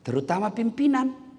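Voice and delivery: usual speaking voice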